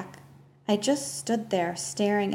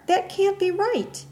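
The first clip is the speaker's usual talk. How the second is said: high, louder disbelief; insist, voice climbing in panic; girl refusing the news; growing stronger; 11 semitones higher, 4 dB louder than usual. Acted. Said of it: "my voice growing stronger".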